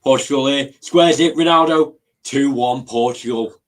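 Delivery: a burst of live play-by-play as Portugal score; excited commentary. Real, not acted. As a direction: call the goal as it happens.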